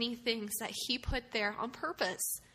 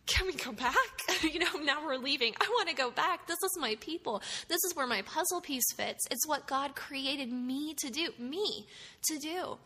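broken-down plea; 4 semitones higher than usual. Retold or imitated. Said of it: "And I just broke".

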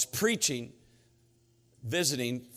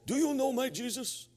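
put-on high-pitched Norwegian-accented question; mock Norwegian accent, pitched way up; strong Norwegian accent; 12 semitones higher than usual. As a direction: imitate an earnest Norwegian fisherman, high-voiced. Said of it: "he was talking in that strong accent".

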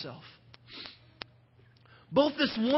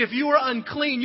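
ordinary delivery

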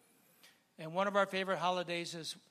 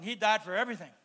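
normal talking voice

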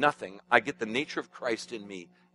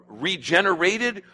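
"regenerated" slow, raised and louder; slow, emphatic word; 5 semitones higher, 6 dB louder, slower than usual. Shown as a demonstration. Preacher building to the key word, slow and loud. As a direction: hit "regenerated" hard and slow. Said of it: "to point out a very important word".

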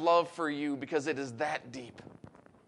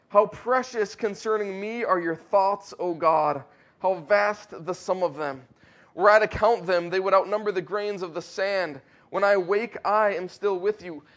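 louder, declaiming psalm reading; fervent scripture reading; 2 semitones higher, 5 dB louder than usual. Acted. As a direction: proclaim the verses like a preacher. Reads psalm aloud fervently.

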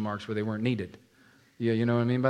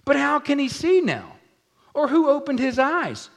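incredulous questioner; 13 semitones higher, 6 dB louder than usual. Acted. Pharisees questioning, acted.

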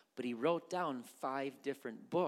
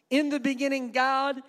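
louder and higher, proclaimed to the crowd; loud preached refrain; 12 semitones higher, 13 dB louder than usual. Shown as a demonstration. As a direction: ring it out like a sermon climax. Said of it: "preached refrain, crowd urged".

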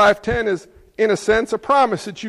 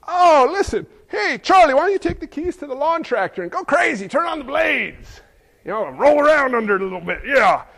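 mock reckless parent; 4 semitones higher than usual. Mock parent offering dangerous lawn tractor.

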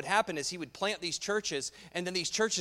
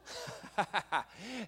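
quick light chuckle; amused laugh from the pulpit; short amused laugh; 5 semitones higher, 4 dB quieter than usual. Real, not acted. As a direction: laugh at the joke in the text. Preacher laughs at text.

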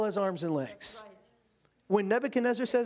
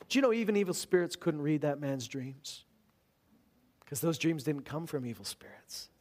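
ordinary delivery

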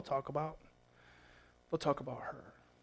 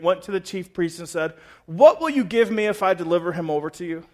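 loud, higher preaching to the crowd; full-force preaching; 4 semitones higher, 9 dB louder than usual. Acted. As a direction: drive the point home from the pulpit.

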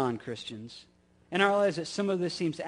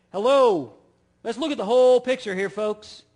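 stern Peter; 4 semitones higher, 9 dB louder than usual. Retold or imitated. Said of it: "it's very stern language".

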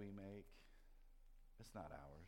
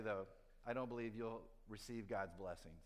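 ordinary delivery

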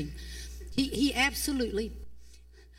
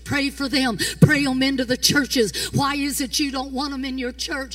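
shouted, rising preacher's cry, higher and louder; preaching at full force; 4 semitones higher, 15 dB louder than usual. Acted. Preacher challenging the congregation, shouting. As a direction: build the sermon to a roaring shout.

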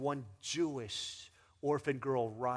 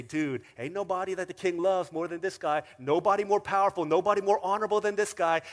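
louder, faster, announcing to the street; herald proclamation; 4 semitones higher, 10 dB louder than usual. Retold or imitated. Preacher voicing the herald praising the honoured man. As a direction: proclaim it like a town crier. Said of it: "and say".

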